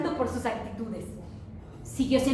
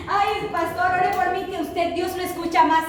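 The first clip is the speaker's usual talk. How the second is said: pleading church member; 4 semitones higher than usual. Retold or imitated. Church member begging pastor.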